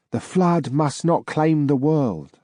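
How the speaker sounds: a hint of a distant spirit intoning; ghostly voice. Ghost warning the narrator, slow and eerie.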